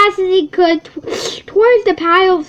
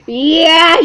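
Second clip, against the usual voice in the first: growled yes; 3 semitones higher, 8 dB louder than usual. A narrator's reading. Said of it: "Bear growled".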